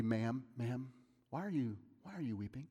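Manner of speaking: soft, quick, tender "Ma'am, ma'am"; gentle modern version. Shown as a demonstration. Preacher voices the angels gently to Mary. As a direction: ask kindly and hushed. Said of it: "if we translated it into a more modern".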